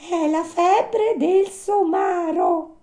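very high, slow animal voice; grave little marmot. Acted. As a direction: give a slow diagnosis in a tiny high voice.

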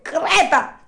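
high, loud puppet voice teasing from afar; a cheeky puppet calling back; Pinocchio's mocking reply. A narrator's reading. Pinocchio teasing the drowning dog from a distance, high and loud. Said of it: "gli rispose Pinocchio da lontana".

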